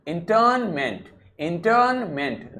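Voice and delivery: इंटरनमेंट with the ra barely said; light ra. Shown as a demonstration. Teacher modelling इंटरनमेंट for students, ra barely voiced. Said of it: "रा को बहुत हल्का सा बोलिए".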